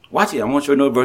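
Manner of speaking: ordinary delivery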